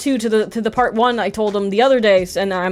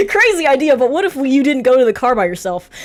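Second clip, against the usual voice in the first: higher, louder, laughing through it; laughing mock suggestion; 7 semitones higher, 4 dB louder than usual. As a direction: tease the obvious plan, laughing. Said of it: "So Shane's like".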